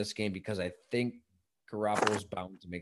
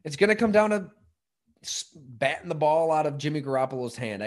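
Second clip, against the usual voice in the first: hammed-up, louder and higher game-preview voice; color commentator cliché; 4 semitones higher, 6 dB louder than usual. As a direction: lean into the sportscaster cliché. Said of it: "I sound like every color commentator before a game".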